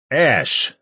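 high urgent shout of a name; alarmed loud cry. A narrator's reading. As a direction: call out to a fallen leader. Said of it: "Jake shouted".

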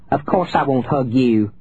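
mildly shrill, cross aunt; understated shrill refusal; shrill scolding. A narrator's reading. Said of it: "Aunt Willa shrilled".

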